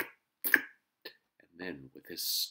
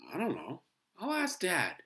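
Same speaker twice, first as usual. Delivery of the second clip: put-on higher, louder boy with a touch of worry; worried; 12 semitones higher, 7 dB louder than usual. A narrator's reading. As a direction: answer uncertainly, mildly worried. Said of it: "Henry was worried".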